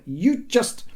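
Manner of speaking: high, sputtered scolding; exasperated mother. A narrator's reading. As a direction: start a high-pitched telling-off and run out of words. Mother scolding, runs out of words.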